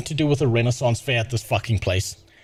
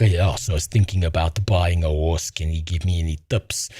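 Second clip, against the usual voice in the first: much lower, dopey bro voice; mocking douchebag; 10 semitones lower than usual.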